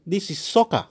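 ordinary talk

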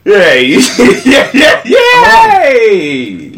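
high, loud squealing yay; excited cheer. Real, not acted. Mock celebrating wrong answer.

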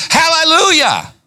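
hallelujah flung out high and ringing; real excited high shout of hallelujah. Real, not acted. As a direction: burst out with joy. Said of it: "I'm trying not to get excited".

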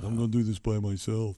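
mock big-headed voice. Quoting the big head.

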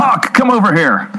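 lightly voiced detective calling his sidekick. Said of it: "calling Hawk like Spencer".

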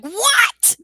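high, loud stunned yelp; shocked what. Retold or imitated. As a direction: blurt it out in total shock. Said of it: "I'm like".

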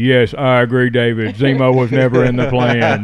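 stiff fake robot voice agreeing. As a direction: say it like a dubbed robot voice. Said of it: "I'm gonna put robot voices in".

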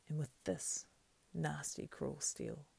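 measured threat at normal pitch; stern threat. Acted. Sam threatening the creature with his sword, stern. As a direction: say it firmly as a warning.

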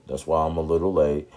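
usual speaking voice

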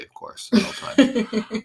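laughing tag. Real, not acted. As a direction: sell the joke with a laugh.

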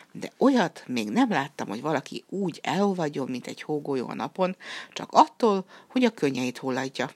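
ordinary delivery, nothing marked

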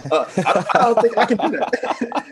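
high, laughing admission. Real, not acted. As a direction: crack up while owning up. Cracking up at attempting the accent.

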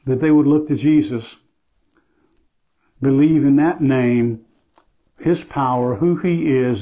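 earnest spoken prayer to God; closing prayer. Acted. Pastor praying over the congregation, earnest prayer. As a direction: pray sincerely for the lost listener.

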